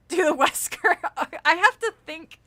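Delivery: laughing loud and high. Real, not acted. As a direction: crack up at chat. Laughing loudly at chat.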